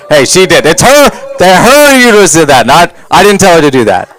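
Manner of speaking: loud, high mock indignation; defensive protest. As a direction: shout it as if wrongly accused.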